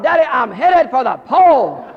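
high, very loud wail to Dad; child hollering. Retold or imitated. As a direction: imitate a panicking kid yelling for help. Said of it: "She started hollering".

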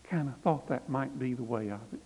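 soft and low, almost under breath; softly, to a weeping woman; real softly. A narrator's reading. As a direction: comfort her quietly. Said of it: "Quentin said real softly".

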